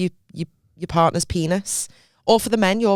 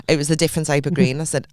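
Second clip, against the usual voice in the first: ordinary talk, nothing marked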